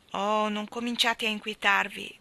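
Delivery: usual speaking voice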